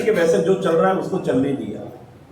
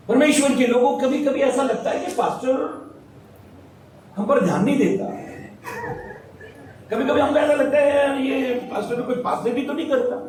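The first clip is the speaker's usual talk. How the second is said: higher, raised preaching voice; 5 semitones higher than usual. Acted. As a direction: press the lesson home to the flock. Preaches to the congregation. (ऊँची आवाज़ में उपदेश देते हुए)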